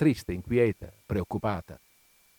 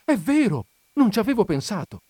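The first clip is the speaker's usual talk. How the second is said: high, louder delighted realization; little cry of joy; 10 semitones higher, 5 dB louder than usual. A narrator's reading. Wife struck by her husband's idea, joyful little cry. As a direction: leap up high with sudden delight. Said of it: "Ella mandò un gridolino di gioia".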